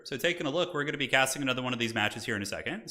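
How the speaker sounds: ordinary talk, nothing marked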